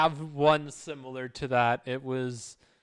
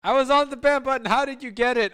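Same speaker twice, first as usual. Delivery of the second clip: excited; 8 semitones higher, 5 dB louder, faster than usual. Real, not acted. Excited recounting of ban.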